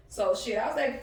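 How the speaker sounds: normal talking voice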